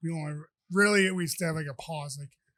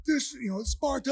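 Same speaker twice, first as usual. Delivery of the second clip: higher, louder imitated movie battle cry; Sparta war cry; 8 semitones higher, 5 dB louder, slower than usual. Acting out cousin's kick to the chest, mock Sparta yell. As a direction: call it out like a mock warrior's kick. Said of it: "a straight Sparta".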